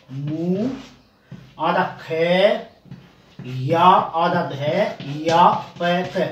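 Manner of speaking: मु आधा खे या slowly, pausing between letters; slow spelling-out starting from मु; letter-by-letter demo. Shown as a demonstration. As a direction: dictate आधा धे या piece by piece. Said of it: "ये मुखिया अध्यापक होता है".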